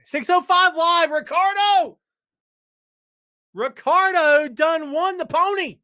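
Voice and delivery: high, loud, stretched announcer call; hype announcer. Acted. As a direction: bellow it like a racetrack announcer. Announcer style shout.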